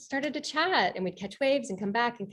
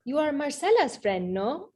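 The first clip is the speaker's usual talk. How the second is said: put-on higher-pitched French-accented question; thick French accent; 6 semitones higher than usual. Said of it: "in this really thick French accent".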